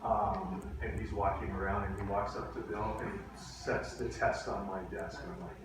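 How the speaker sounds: ordinary talk, nothing marked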